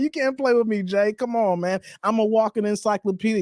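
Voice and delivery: ordinary talk, nothing marked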